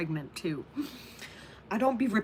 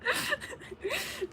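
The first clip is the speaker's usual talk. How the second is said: squeaky crying laugh; laughing till it hurts; high wheezing laugh; 6 dB quieter than usual. Real, not acted.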